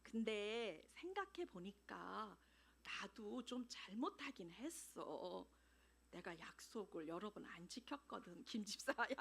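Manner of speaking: 낮고 느리게 잘못을 인정하는 말투; slow and meek, owning up; soft admission. Retold or imitated. Wife softly admitting fault. (남편에게 잘못을 인정하는 아내, 조용하고 느리게)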